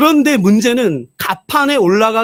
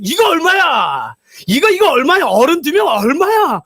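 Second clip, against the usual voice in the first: shouting; 5 semitones higher than usual. Retold or imitated. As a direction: bellow the price question. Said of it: "하도 소리치니까".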